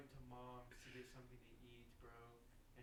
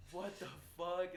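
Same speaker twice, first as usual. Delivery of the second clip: loud, slow shocked exclamation; retold alarmed cry, pitched up; scared; 5 semitones higher, 13 dB louder, slower than usual. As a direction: react in drawn-out alarm. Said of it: "And they were scared".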